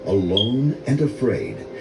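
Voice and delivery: usual speaking voice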